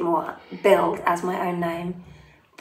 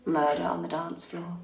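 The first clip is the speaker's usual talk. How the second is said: sinister voice; 7 dB quieter than usual. Shown as a demonstration. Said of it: "say the name of your song in a sinister voice".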